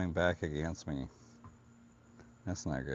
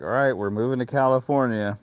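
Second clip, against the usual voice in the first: lightly voiced dad, higher and louder; dad announcing; 5 semitones higher, 8 dB louder than usual. Retelling dad's news of moving to California, mock dad announcing. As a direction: imitate dad's loud announcement. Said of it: "my dad was like".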